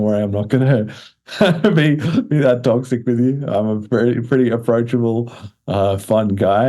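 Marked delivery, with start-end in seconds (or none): none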